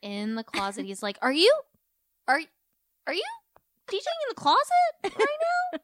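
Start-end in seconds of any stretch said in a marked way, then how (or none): 1.2-5.8 very high, loud, halting question; mock incredulous. Podcaster playing Jax catching James DJing in a closet, mock incredulous. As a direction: stammer it in squeaky mock disbelief.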